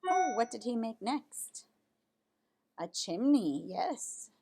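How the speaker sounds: usual speaking voice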